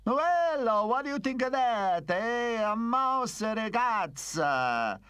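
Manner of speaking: theatrical Italian mobster; gloating Italian accent. Acted. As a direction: crow over the captive in an Italian accent. Italian boss inspects his captive.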